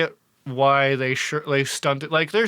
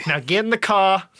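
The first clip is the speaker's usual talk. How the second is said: put-on Brooklyn accent; 4 semitones higher than usual. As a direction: bark it like a Brooklyn mobster. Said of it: "real thick Brooklyn accent".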